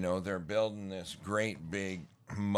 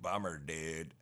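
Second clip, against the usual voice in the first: valley dude; 4 semitones lower, 6 dB quieter than usual. Retold or imitated. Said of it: "That's where the Valley talk came from".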